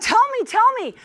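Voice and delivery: high-pitched, eager plea; eager urging. Retold or imitated. Friend pressing him for details over lunch, eager urging. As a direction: press for the gossip, pitch jumping up.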